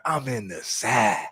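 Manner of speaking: slow phrase, "side" stretched into "sah" for South; drawled "South". Shown as a demonstration. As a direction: draw "side" out as a Southern "sah", no final consonant. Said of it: "You don't have to say it like that".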